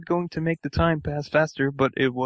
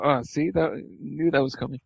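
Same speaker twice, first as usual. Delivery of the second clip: ordinary delivery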